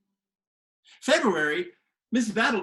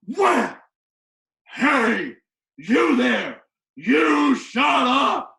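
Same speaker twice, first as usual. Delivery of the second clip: high, loud, slow bellow; teacher yelling at a cheeky boy, louder; furious shout; 5 semitones higher, 8 dB louder, much slower than usual. Retold or imitated. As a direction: blast it at the boy. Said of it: "she went".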